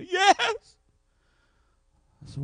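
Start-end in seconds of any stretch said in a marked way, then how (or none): 0.0-0.8 high crying "Yes"; mock sob. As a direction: sob out the answer. Crying mother answers.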